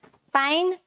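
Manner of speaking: usual speaking voice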